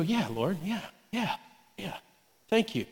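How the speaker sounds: hushed, yielding agreement; small, soft, submissive voice; meek soft reply. Acted. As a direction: answer very quietly, giving in to a gentle correction. Acted meek reply to God's nudge.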